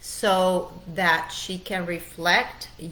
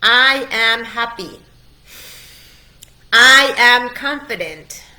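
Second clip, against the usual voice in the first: louder, higher declaration to be repeated; loud affirmation; 5 semitones higher, 12 dB louder than usual. Shown as a demonstration. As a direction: proclaim it so they shout it back. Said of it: "I want you to repeat that with me".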